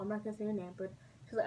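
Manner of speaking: ordinary delivery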